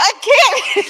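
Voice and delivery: high, loud hammed-up wail; mock wail. Retelling her tearful reply to family, high mock wail. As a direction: squeal it like you're breaking down.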